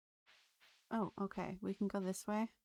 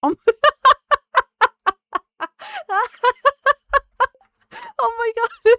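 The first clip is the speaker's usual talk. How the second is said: high, loud shrieking laughter breaking through the words; can't stop laughing, gasping out oh my God; helpless laughing fit; 18 dB louder than usual. Real, not acted. Streamer cracking up at a chat message, laughing hard. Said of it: "Oh, I'm laughing".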